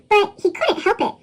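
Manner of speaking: ordinary delivery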